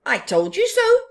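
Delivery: loud, high told-you-so; gloating happily; high, loud smug Baboon. Acted. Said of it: "said Baboon".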